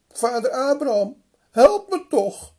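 hoog en luid smeken; smekende roep. Acted. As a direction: roep om hulp, wanhopig. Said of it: "En hij vraagt dan". (rijke man smeekt Abraham luid om hulp)

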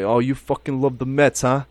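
teasing a friend in a hammed-up Italian-American accent; mock East Coast Italian accent. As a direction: bust his chops in a New York Italian accent. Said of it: "Anytime I do an Italian accent".

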